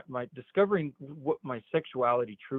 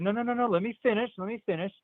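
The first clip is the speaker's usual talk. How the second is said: imitated insistent reassurance; 8 semitones higher, faster than usual. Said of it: "I said".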